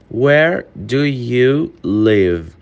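very slow, each word drawn out; slow it right down for learners; careful slow model sentence; slow demo. Shown as a demonstration. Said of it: "repeated at normal pace".

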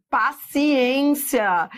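slow, stretched syllables; drawn-out plea. Real, not acted. Teacher asking for attention and patience, drawn out. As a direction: drag the word out patiently.

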